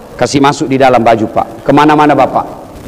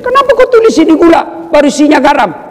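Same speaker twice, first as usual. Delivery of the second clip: mock complaint; 12 semitones higher, faster than usual. Complaining to wife about salt.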